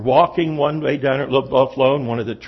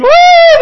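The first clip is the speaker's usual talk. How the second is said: very high, loud, long "woo" shriek; sissy little-boy scream; 12 dB louder than usual. Acted. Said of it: "like a sissy little boy".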